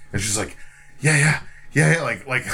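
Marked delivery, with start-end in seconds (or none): 1.0-2.0 mock-eager yeses, higher and louder; eager little kid. Wife agreeing like a little kid, mock eager. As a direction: imitate a thrilled kid, all smiles. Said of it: "like a little kid, like, all smiling".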